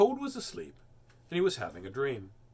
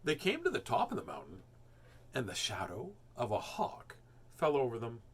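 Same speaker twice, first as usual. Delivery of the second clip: plain reading voice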